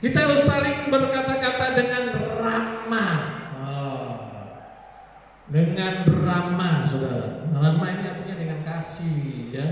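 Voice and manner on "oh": ordinary delivery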